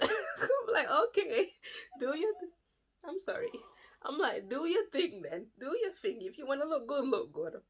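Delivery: high, breaking into laughter; laughing. Real, not acted. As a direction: laugh through the words. Laughing at husband's best suit.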